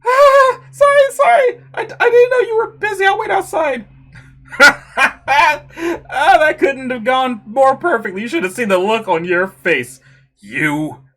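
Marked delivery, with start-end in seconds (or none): 0.0-3.9 much higher, loud squealing apology; flustered screaming. Acted. Girl walking in on a nude modelling session, flustered screaming. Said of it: "Are they both screaming?". 4.6-8.2 gleeful laugh. Acted. Prankster gloating after prank.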